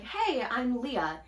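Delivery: ordinary talk, nothing marked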